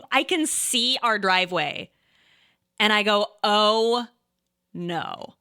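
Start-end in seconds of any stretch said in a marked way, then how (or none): 3.4-5.3 mock dismay with a pause before "no"; retold dawning dread. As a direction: act out a sinking realisation. Retold moment of sudden realisation.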